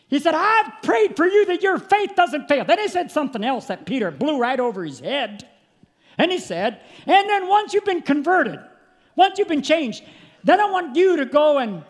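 high, loud revival preaching; preaching at full force. Acted. Preacher to congregation about Jesus praying, preaching at full force. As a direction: preach it out to the church, full throat.

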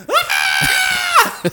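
very high, loud tased scream; mock scream. Teller imitates the tased driver's scream. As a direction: shriek as the taser hits.

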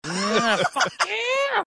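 high, squeaky imitation of a victory yell; hammed-up gloating cry; mock celebration scream. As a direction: screech it in mock triumph without much volume. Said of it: "screams in your face celebrating".

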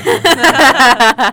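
laughing loudly. Real, not acted. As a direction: laugh through it. Laughing greeting to producer.

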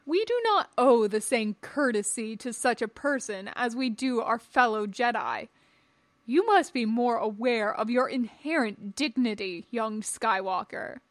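emphatic, imperious lecture; haughty Jedi master. Acted. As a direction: instruct the pupil in superiority with full conviction.

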